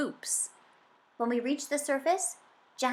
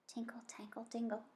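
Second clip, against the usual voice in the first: much softer, hushed bell sounds; soft jingling; 15 dB quieter than usual. A narrator's reading. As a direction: breathe the chimes faintly, far away. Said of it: "It was kind of soft at first".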